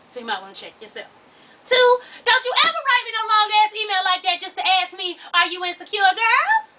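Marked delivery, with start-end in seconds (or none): 1.7-6.7 loud, high mock telling-off, jumping up at the end; mock scolding. Host teasing an email writer over a long message, mock scolding. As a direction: scold them playfully at full volume.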